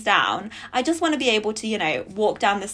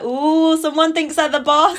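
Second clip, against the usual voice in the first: mocking tease; 7 semitones higher, 6 dB louder, slower than usual. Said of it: "She's like".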